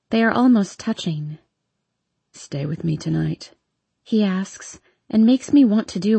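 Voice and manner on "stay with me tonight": low pleading question. A narrator's reading. Hardin asks softly.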